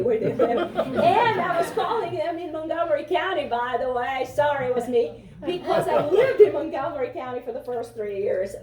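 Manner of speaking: chuckling through the words; a laughing aside to the host. Real, not acted. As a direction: laugh while confessing. Laughing admission about Montgomery County.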